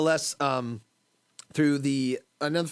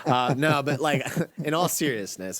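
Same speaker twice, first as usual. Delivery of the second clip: normal talking voice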